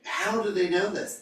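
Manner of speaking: high, mock-baffled question; astonished onlookers. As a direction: ask it as the amazed crowd, pitched well up. Acting out amazed onlookers' question.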